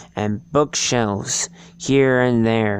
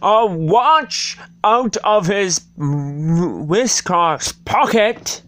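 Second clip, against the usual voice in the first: high, slow squeaky rabbit; 7 semitones higher, 3 dB louder, slower than usual. A narrator's reading. Rabbit voiced while reading.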